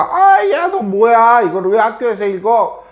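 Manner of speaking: high-pitched scolding of a classmate; mock angry schoolkid. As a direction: act out an indignant kid's challenge. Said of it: "화내는 친구도 있고".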